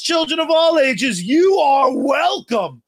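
booming circus announcer call; ringmaster. Acted. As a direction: open it like a big top. Show opening announcement.

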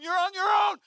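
high, loud yell on the run; panicked shout. Retold or imitated. Fleeing friend abandons his buddy, high frantic shout. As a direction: shout it back while fleeing.

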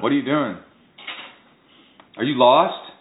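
a hint of New York in the grilling; New York accent. Mimicking the neighborhood watch in a light New York accent. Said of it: "This was in a New York accent".

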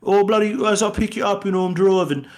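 put-on very high, over-the-top British accent; Helen Mirren British impression. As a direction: ham up a Cockney lady offering a lift. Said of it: "Helen Mirren's like".

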